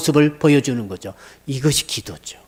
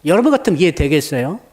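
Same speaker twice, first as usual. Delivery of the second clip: ordinary talk, nothing marked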